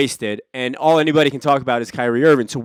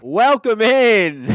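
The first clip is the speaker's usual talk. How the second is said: drawn-out, high, loud welcome; show intro; 10 semitones higher, 5 dB louder, much slower than usual. Acted. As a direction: greet the listeners like a host opening the show. Podcast opening greeting.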